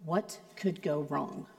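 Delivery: low, soft, ominous question; wife's ominous version. Retold or imitated. Wife warning darkly, low and soft. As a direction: drop low and quiet like a warning. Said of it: "totally different from when my wife says".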